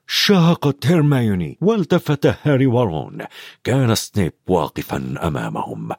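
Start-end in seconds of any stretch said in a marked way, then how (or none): none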